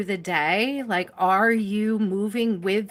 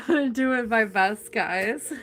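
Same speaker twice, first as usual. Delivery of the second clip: laughing; slower than usual. Real, not acted. Laughing apology to viewers.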